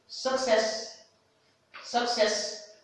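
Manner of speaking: success dit haut, U comme E; English model word. Shown as a demonstration. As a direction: success en modèle, voix haute. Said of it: "ce n'est pas U, mais success". (success avec E, voix haute)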